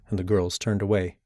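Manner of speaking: usual speaking voice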